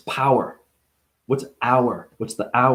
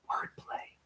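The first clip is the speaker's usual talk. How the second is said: breathy stage whisper; secretive whisper; whispered aside; 19 dB quieter than usual. Acted. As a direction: whisper it to the audience. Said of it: "whispered aside".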